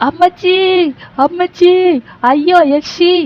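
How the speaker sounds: high, loud panicky cries; terrified wailing. Acted. Boy begging the yakshi at the door, wailing in fear. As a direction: wail for mommy in terror.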